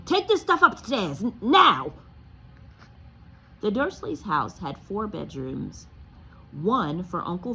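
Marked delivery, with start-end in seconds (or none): 0.0-1.9 high, loud stammered command; barked order. Acted. Uncle Vernon to Harry, a loud stammering order. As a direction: order him off.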